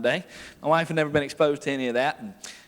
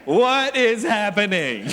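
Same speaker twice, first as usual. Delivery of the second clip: slow, laughing through a mock-stunned question; laughing mock-bewildered wife; laughing wide-eyed quote; 6 semitones higher, 6 dB louder, much slower than usual. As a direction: replay her shock while laughing. Wife's shocked whisper retold, laughing.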